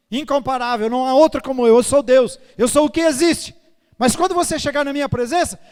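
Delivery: full-force preaching proclamation; mighty proclamation. Pretend. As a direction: thunder it out as almighty God. Preacher voicing God's majesty.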